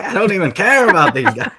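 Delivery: fast, high dismissive rant; complaining about boring wrestlers, fast and high; exasperated inner voice. Retold or imitated. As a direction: rattle it off in exasperation. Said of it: "I'm like".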